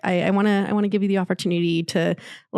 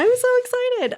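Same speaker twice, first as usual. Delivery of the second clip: high-pitched, playful gush of excitement; hammed-up excitement. Co-host showing how excited she is about the guest, hammed-up excitement. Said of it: "She looks excited to me".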